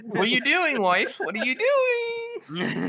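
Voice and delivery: high, squeaky mock protest; comic husband squealing at his wife; hammed-up panicked husband. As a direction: plead in a very high put-on voice.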